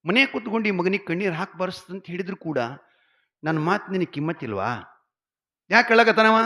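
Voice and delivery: husband's rising angry rebuke, ending in a shout; angry scolding. Retold or imitated. Husband scolding his wife over the crying son, building to a shout.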